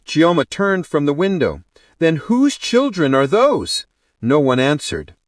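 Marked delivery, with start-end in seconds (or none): 2.0-3.9 demanding. A narrator's reading. Bride demands an answer.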